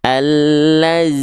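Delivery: ALLAZI with a long sharp Z buzz; zai replacing zal. Shown as a demonstration. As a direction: draw out a sibilant z in "Allazi". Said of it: "itu tuh bukan huruf zal". ("Allazi", desis zai yang tajam)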